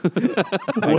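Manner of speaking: high, loud laughing what; a sudden amused what; surprised what. Real, not acted. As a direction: burst out laughing in disbelief. Friend cuts off confession.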